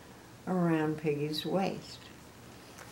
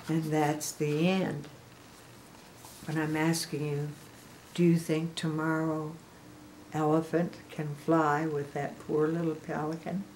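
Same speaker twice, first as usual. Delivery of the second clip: usual speaking voice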